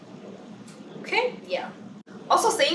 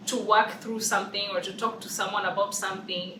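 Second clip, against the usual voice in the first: normal talking voice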